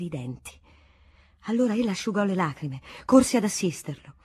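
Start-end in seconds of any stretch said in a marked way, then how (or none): none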